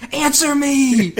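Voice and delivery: drawn-out, high and loud imitation yell; hammed-up scream demanding a reply; mock screaming. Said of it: "Luigi screaming back".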